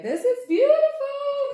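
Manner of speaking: high, loud burst of delight; excited. Real, not acted. Showing viewers the skein, exclaiming in high, loud delight. Repeated gushing, first take loud.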